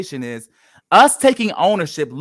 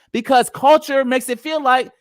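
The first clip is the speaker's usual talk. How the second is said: ordinary delivery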